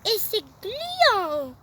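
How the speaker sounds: very slow drawn-out disgust. Acted. Tom disgusted by the slimy liquid, slow. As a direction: stretch it out, grossed out.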